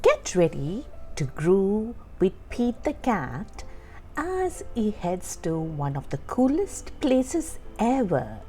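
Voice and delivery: usual speaking voice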